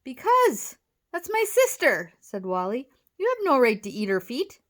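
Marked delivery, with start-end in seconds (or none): 0.1-2.1 high, loud protest; indignant outburst. A narrator's reading. Boy confronting slug over his sister. 3.2-4.6 loud, raised objection; indignant protest. A narrator's reading. Boy confronting slug over his sister.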